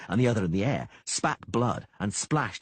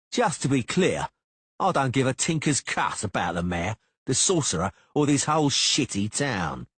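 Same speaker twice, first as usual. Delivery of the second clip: higher, deliberate, each word leaned on; hostile, deliberate thug; emphasizing each word; 5 semitones higher, 4 dB louder than usual. A narrator's reading. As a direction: spell it out to him with contempt. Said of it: "slowly emphasizing the words".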